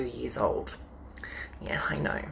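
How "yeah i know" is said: whispered aside. Real, not acted. Joking aside about her age.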